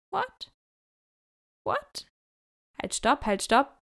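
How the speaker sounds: high squeaky what, what; incredulous high-pitched what; stunned disbelief. Retold or imitated. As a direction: shoot up in pitch in shock. Shocked at his offer.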